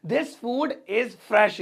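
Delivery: drawn out word by word; slow it right down for learners to repeat; very slow model sentence. Shown as a demonstration. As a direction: dictation pace. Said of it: "slow repeated model sentence".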